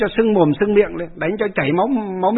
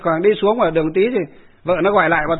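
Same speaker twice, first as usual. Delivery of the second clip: ordinary talk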